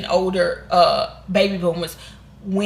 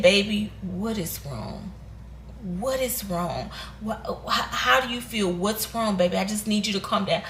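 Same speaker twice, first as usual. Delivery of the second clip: calm voice; 6 dB quieter than usual. Retold or imitated. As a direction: hush her gently, a steady calm parent. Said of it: "from a calm voice, not a panic voice".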